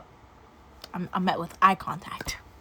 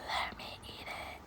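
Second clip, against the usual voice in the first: whisper; 15 dB quieter than usual. Retold or imitated. Boyfriend voiced in whisper.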